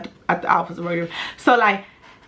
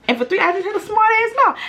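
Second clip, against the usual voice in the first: much higher, put-on voice on the repeat; high mock voice; 13 semitones higher, faster than usual. Shown as a demonstration. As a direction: jump way up for the punchline. Says the line twice.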